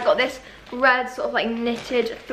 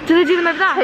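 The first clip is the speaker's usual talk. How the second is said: excited; 6 semitones higher, 5 dB louder, much faster than usual. Real, not acted. Thrilled at meatball news.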